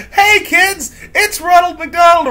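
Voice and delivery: hammed-up cheerful mascot; mock clown greeting.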